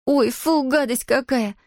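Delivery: put-on high-pitched girlish revulsion; disgusted. A narrator's reading. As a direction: recoil from a foul smell. Girl recoils from stinking breath.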